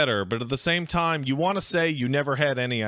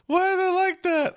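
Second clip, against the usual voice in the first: high, laughing disbelief; amused; 14 semitones higher than usual. Real, not acted. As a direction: squeak it out amused. Laughing at bulldogs.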